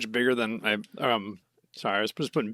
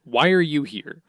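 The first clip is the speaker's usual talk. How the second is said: louder, higher annoyed demand; retold cross challenge; mock exasperation; 5 semitones higher, 5 dB louder, slower than usual. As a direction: imitate being fed up with an intruder. Retelling a character cutting off another.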